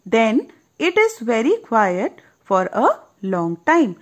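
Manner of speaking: slow drawn-out read-aloud; storybook narration. A narrator's reading. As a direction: savour the hush of the story. Storybook line read slowly. (धीमी कहानी वाली आवाज़)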